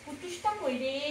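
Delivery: ordinary delivery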